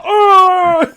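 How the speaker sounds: loud, high howl of pain; imitated cartoon villain's yelp; Cell. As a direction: cry out as if just kicked. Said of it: "you just see Cell".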